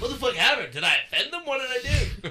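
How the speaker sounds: high, loud bewildered cries; retold panic. Man wonders if he offended spiders, high panicked cries. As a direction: act out frantic confusion. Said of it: "I have a fucking panic attack".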